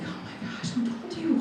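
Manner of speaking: starts whispered, rises to a high squeak; acted gasp of being found out, kept soft; small, high, stunned question; hushed shock. Reacting to mom knowing secret.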